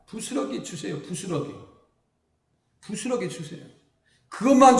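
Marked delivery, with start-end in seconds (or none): 0.1-3.6 quiet begging woman. Retold or imitated. Woman begs Jesus for crumbs.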